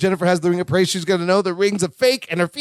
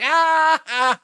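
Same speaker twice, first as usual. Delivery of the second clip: mock laugh; 9 semitones higher than usual. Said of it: "Balky's laughing real hard".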